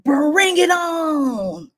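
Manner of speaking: high, slow, loud taunt; a mad little kid daring a fight; tiny woman with fists balled, daring a fight; angry child voice. Retold or imitated. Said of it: "she's like".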